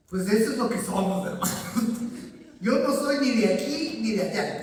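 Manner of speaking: ni de aquí ni de allá declared loudly and firmly; loud declaration. Real, not acted. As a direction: proclaim Yo no soy ni de aquí ni de allá with full force.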